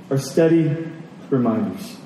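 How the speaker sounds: ordinary talk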